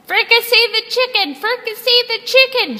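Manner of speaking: high, loud chanting yell; dirty-haired pirate roaring for chicken; pirate shout. Acted. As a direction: bellow it at the crew. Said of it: "shouted a pirate with extremely".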